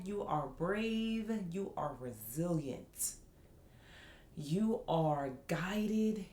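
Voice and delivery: slow, measured affirmations with drawn-out key words; spoken affirmations. Acted. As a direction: reassure the listener, one slow affirmation at a time. Recites affirmations to listeners.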